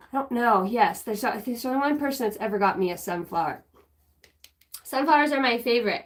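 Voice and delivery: usual speaking voice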